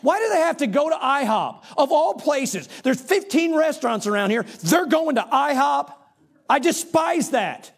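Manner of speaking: high-pitched exasperated rant; irritated. Retold or imitated. Husband ranting to his wife about the restaurant, irritated. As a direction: re-enact an indignant tirade, pitched well up. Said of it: "It's exactly the way I said it".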